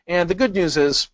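ordinary delivery

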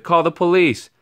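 high, loud panicked call; shout. A narrator's reading. Townsperson calling for police, loud shout. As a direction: cry for help. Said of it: "somebody shouted".

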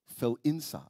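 usual speaking voice

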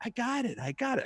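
high, soft mock-frustrated protest; frustrated stoner. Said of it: "He starts getting frustrated".

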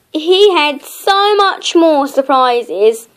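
plain reading voice

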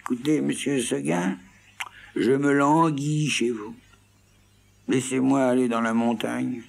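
voix de petite chèvre, plus aiguë, plaintive; chèvre qui supplie. Acted. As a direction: supplier doucement, plus aigu et lent. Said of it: "lui dit dans son patois". (la chèvre supplie Seguin, aiguë et plaintive)

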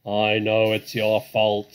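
loud, slow, angry badger; booming, heavy indignation; furious. A narrator's reading. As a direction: throw the blame back loudly and slowly. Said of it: "said the Badger furiously".

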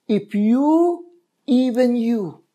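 lamenting plea. Acted. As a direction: plead like a grieving prophet. Jesus laments over Jerusalem.

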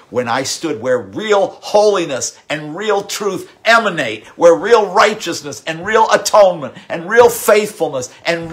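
building from steady to loud and high; preaching at full force. Acted. Preacher building to the sanctuary revelation, rising to a loud climax. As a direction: climb to a ringing peak on each "real".

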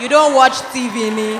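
loud, playful challenge; loud mock-challenge to a shy guest; loud teasing jab. Real, not acted. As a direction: call it out loud to tease him. Teasing the guest about accents.

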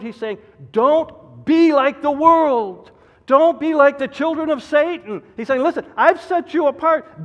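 loud, high, driving pulpit delivery; preaching at full force. Acted. Pastor warning his congregation, preaching at full force. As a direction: hammer the warning home from the pulpit. Voicing God's command from the pulpit.

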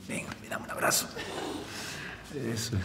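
whispered request for a hug; soft whisper, holding back tears; tender whisper. Acted. Father whispers to his son for one last hug.

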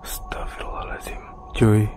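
hushed, frightened whisper; scared whisper. Real, not acted. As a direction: whisper it in fear. Spotting a shadow in abandoned building.

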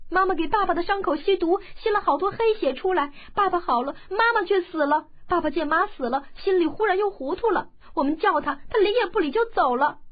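put-on high, loud child's voice pouring it out; a young boy telling his father's fate, upset; tearful child. A narrator's reading. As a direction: tell it through tears, breathless. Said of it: "武修文道".

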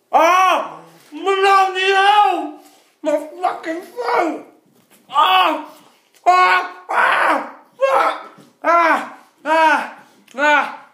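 high, loud screams of burning; pained screaming. Real, not acted. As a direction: scream through the burn. Throat burning, screaming in pain.

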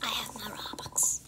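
softly whispered I have my Robux; voiceless whisper of I have my Robux. Acted. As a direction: breathe it out secretly. Whispered, then said aloud.

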